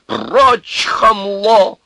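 high, loud, abusive shout; explosive angry dismissal; furious yell. A narrator's reading. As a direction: yell the insult while shoving. Shoves the man, yelling abuse.

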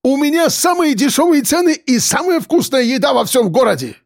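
high-pitched, heated self-defence; flared-up, high character voice; indignant boast. A narrator's reading. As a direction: boast hotly, deeply offended. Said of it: "вспыхнул Акбар".

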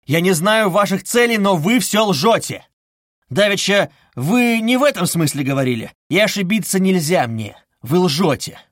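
loud, high-pitched accusation; angry cry. A narrator's reading. Cornered suspect accusing his investigator, angry outcry. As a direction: hurl it at the investigator. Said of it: "вскричал он".

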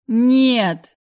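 loud anguished scream. Acted. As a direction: scream it out in horror. Gun aimed at brother's head.

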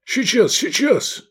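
high, strained promise; hurried reassurance. A narrator's reading. Rescuers answer the groaning man, urgent reassurance. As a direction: answer urgently, pitched well up.

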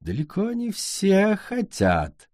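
slow, grand and condescending; haughty queen. Acted. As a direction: talk down like a grande dame.

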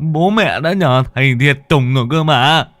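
put-on anxious old man. Frightened father asking his ghost children what hurts. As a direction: fret over the children. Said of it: "Lão Sửu rôn rôn".

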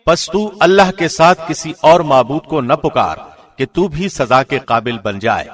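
ordinary talk

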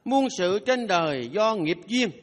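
slow solemn maxim. Shown as a demonstration. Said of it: "recites a maxim".

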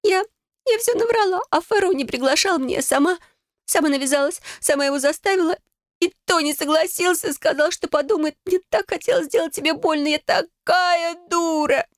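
high-pitched sobbing confession; tearful wailing. A narrator's reading. Sister confessing her lie to Adele, weeping. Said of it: "она разрыдалась и обняла меня".

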